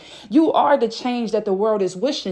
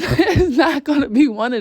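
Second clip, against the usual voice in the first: real laugh breaking into the words; 5 semitones higher, 3 dB louder than usual. Real, not acted. Reader tickled by her own message about fame, laughing. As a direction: talk through a higher-pitched laugh.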